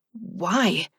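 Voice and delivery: plain reading voice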